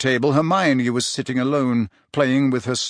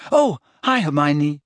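beaming; 3 semitones higher than usual. A narrator's reading. Parvati greeting Hermione out of guilt, beaming. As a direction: greet her with a sudden beam in the voice. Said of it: "Parvati positively beamed".

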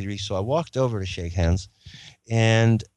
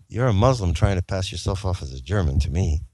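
ordinary talk, nothing marked

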